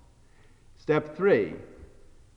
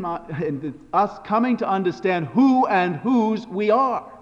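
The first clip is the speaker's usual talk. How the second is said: normal talking voice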